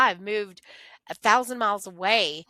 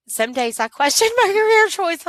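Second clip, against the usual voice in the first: laughing; 11 semitones higher, 10 dB louder than usual. Real, not acted. Laughing self-doubt about career.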